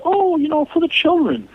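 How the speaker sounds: high-pitched mock plea; mimicking everyone. Guest mimics everyone pleading for the children, high mocking imitation. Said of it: "everyone's like".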